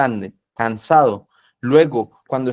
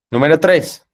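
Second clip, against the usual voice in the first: loud announcement; 3 semitones higher, 6 dB louder than usual. Real, not acted. Preacher announcing the next point.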